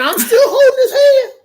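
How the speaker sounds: very high, laughing storyteller; high-pitched amused emphasis. Real, not acted. Telling Charles he was still holding the hand, high laughing squeal.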